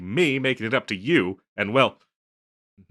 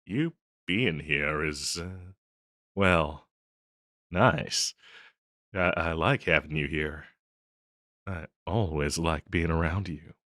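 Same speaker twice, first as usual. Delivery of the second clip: lower, hesitant, heartfelt; tender admission; 4 semitones lower, 3 dB quieter than usual. Acted. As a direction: confess affection shyly, with pauses.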